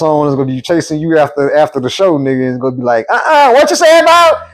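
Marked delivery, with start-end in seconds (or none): 3.1-4.5 high squeaky indignant voice; high pitched voice. Retold or imitated. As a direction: jump way up into a squeaky register. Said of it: "your voice get high pitched".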